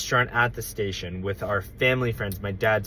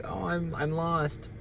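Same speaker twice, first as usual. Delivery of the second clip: small lost voice; 5 semitones higher, 9 dB quieter, slower than usual. Retold or imitated. Lost kid to old lady.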